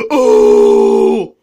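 very low loud far groaning oh; trademark oh. Acted. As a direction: drop into a deep booming oh. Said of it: "my trademark noise".

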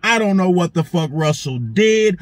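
normal talking voice

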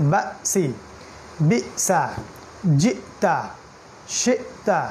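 বাসি, বিসা, জিতা, শিতা each split by an abrupt catch after the first syllable; pushed hamza break. Shown as a demonstration. Teacher reviews the hamza rule, abrupt break inside বাসি, বিসা, জিতা, শিতা. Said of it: "বরং ধাক্কা দিয়ে".

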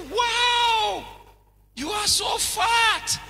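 high, loud shocked exclamation; astonished shout. Retold or imitated. Preacher retelling his shock at a big person in America, loud and high. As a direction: blurt it out in loud amazement.

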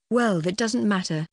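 ordinary delivery

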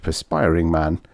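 ordinary delivery

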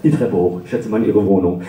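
ordinary talk

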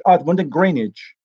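normal talking voice

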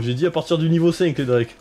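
normal talking voice

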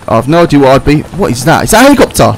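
ordinary delivery

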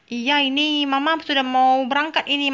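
ordinary talk

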